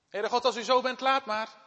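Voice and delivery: high and loud, giving up; bitter outburst. Acted. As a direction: Jona throwing it back at God. Jona's bitter complaint. (bitter en luid)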